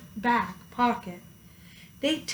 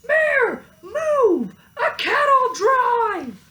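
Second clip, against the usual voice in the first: very high, loud warning scream; firemen screaming; 13 semitones higher, 7 dB louder, slower than usual. A narrator's reading. Firemen warning the mayor, very high and loud. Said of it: "The firemen screamed".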